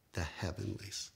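whispered, hushed awe; awed whisper. Acted. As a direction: breathe the word like a secret. Awed hush about heaven.

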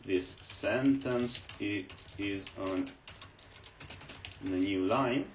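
words drawn out slowly while typing; dictating a sentence slowly, word by word; slow dictation. Shown as a demonstration. Teacher to viewers, slowly dictating the sentence he types.

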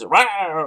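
short mock lion roar. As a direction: imitate a roaring lion. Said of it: "he goes to the giraffe and says".

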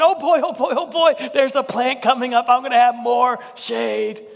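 high, overjoyed squeal of delight; giddy Jonah. Retold or imitated. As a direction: play Jonah hopping with glee over his shade. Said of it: "he's jumping up and down, saying".

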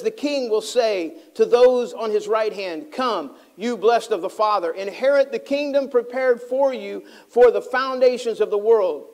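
measured, declaiming Bible reading; scripture reading. Acted.

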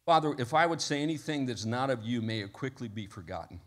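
hushed pastoral prayer, voice dropping at phrase ends. Real, not acted. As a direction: address God quietly and earnestly. Leads the congregation in prayer.

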